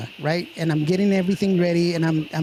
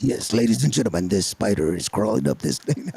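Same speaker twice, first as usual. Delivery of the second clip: low, dramatic trailer announcer; movie trailer voice; 9 semitones lower than usual. Pretend. Mock trailer narration for the spider movie. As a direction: announce the spider like an epic film. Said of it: "I'm gonna make a movie".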